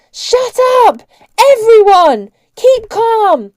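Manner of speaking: very loud, high-pitched bellow; full-force shouted commands, slowing at the end. A narrator's reading. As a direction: bellow orders over the din. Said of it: "Cart was bellowing".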